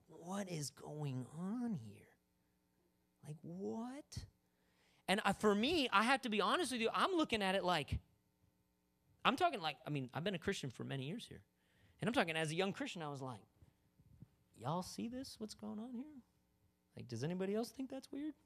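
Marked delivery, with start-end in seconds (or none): 0.1-4.2 slow, hushed disbelief; baffled whisper. Retold or imitated. 14.6-18.3 high, soft, incredulous aside; hushed bafflement. Retold or imitated.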